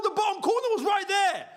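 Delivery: high, exasperated wail over a miss; frustrated outcry. Real, not acted. Fan groaning at the screen after a missed shot, high. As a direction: moan at the chance that was wasted.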